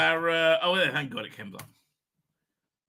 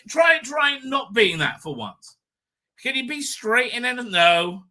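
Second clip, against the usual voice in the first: louder, higher, slow jab; mock shouted rant; 7 semitones higher, 3 dB louder, slower than usual. Host snaps at the actor to change his act, loud and higher. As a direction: bark it out like an exasperated critic.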